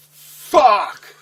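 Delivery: loud, high curse; cursing the news out loud; dismayed yell. Retold or imitated. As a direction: blurt it out in dismay. Reaction to the bad news.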